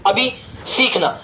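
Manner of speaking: ordinary talk, nothing marked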